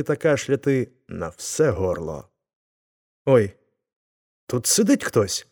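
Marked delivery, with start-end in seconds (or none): 3.2-5.5 mildly alarmed exclamation; a touch of crowd panic; frightened cry. A narrator's reading. Everyone discovers someone inside the sack, mild frightened cry. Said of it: "закричали всі і з переляку".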